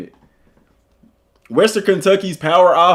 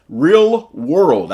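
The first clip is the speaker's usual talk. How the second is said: slowed-down "Real World", each word careful; deliberately slow "Real World"; said slowly; 3 dB louder than usual. Real, not acted. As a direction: ease through "Real World" so he doesn't tongue-twist. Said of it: "I gotta say that slow".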